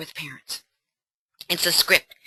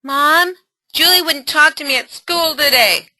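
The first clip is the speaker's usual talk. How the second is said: put-on higher teenage daughter complaining; 8 semitones higher, 6 dB louder than usual. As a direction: play the upset teen girl again, higher. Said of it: "ally role play".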